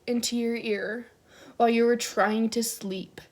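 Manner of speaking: usual speaking voice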